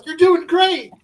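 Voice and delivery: high, loud imitated encouragement; mock cheering on. Wife encouraging a pinned wrestler, imitated high cheer. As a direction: pipe up with a high-pitched cheer. Wife's cheer acted in anecdote.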